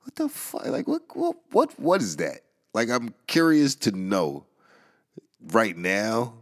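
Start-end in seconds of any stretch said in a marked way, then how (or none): 0.0-2.4 squeaky, high disbelief; high-pitched mock bewilderment; baffled squeak. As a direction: squeal it like you can't believe it. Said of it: "and I'm like".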